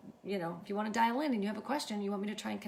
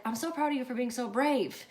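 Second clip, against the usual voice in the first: re-enacted praise, raised and louder; proud gushing; 6 semitones higher, 6 dB louder than usual. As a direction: gush proudly to a brave niece. Retold praise of niece.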